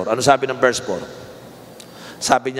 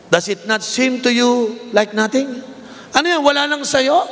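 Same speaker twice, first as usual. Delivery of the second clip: challenging voice; 10 semitones higher than usual. Acted. Pastor voicing God's challenge.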